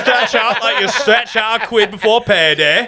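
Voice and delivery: high mock cockney; cheeky London geezer; English accent. Friend joining the yoga bit, mock cockney.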